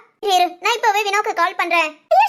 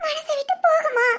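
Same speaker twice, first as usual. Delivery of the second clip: tearful; 5 semitones higher than usual. Acted. Girl still crying.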